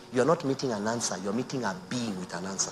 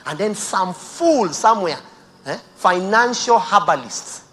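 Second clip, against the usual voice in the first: loud, slow, higher scorn; preacher mocking the money men; scornful outburst; 8 semitones higher, 15 dB louder, slower than usual. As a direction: spit out the insult slowly.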